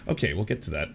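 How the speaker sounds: ordinary talk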